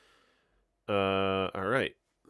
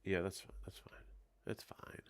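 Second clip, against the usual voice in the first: whispering; 7 dB quieter than usual. Real, not acted. Quiet reassurance to herself.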